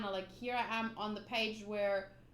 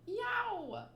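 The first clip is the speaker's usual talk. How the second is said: cartoon yelp; 8 semitones higher than usual. Voicing a pained cry.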